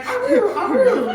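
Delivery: high, yappy little puppy howl. Retold or imitated. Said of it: "He used to be like".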